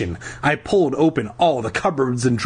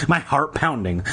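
usual speaking voice

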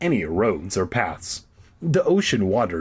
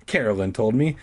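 ordinary talk

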